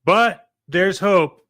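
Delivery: over-the-top commercial reassurance; mock infomercial read. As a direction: deliver the hopeful turn like a TV ad.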